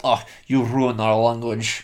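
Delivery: slow, drawled fake French accent; a jokey French accent sneer; mock French accent. Mocking a tourist in a fake French accent. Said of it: "It's a good French".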